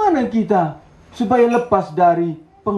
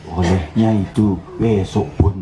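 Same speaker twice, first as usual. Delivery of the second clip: low-pitched, lightly played tempter; lightly voiced devil; 11 semitones lower than usual. Devil putting off a believer's task, lightly voiced low tempter. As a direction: put the devil's delay in a lower voice. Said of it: "Mungkin iblis bilang".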